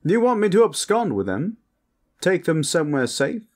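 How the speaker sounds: high-pitched, eager vampire voice; posh vampire. Acted. Vampire asking partner about hiding the traveler, high and eager.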